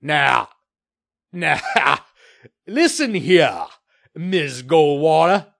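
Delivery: slow, loud, warning growl. A narrator's reading. Developer cornered by the reporter, growling. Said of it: "Murtaugh growled".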